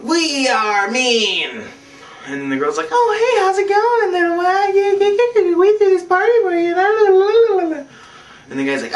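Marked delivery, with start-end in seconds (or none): 0.0-1.6 higher, drawn-out bully voice; mock bullies. Group of bullies introduced in story. 2.9-7.9 high squeaky girl impression; mock girl voice. Voices the girl greeting him.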